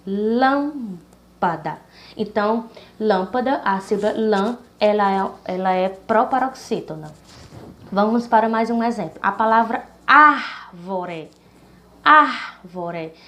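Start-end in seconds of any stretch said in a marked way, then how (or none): 0.1-1.8 lâmpada, very slowly drawn out, syllable by syllable. Shown as a demonstration. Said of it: "a mais forte seria lã". 9.2-13.1 árvore, ár said loud and long; stress on ár. Shown as a demonstration. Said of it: "a sílaba ar, ela é a mais forte".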